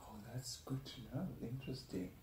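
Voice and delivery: ordinary talk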